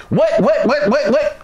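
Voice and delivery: rapid high-pitched startled whats; panicked clucking. Retold or imitated. Man caught on the toilet as the door opens, panicked clucking. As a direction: squawk it like a startled hen. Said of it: "clucked at each other like chickens".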